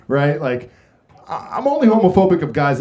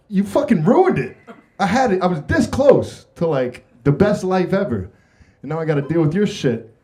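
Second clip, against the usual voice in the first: mock angry Adam; 6 semitones higher than usual. Adam scolding Eve.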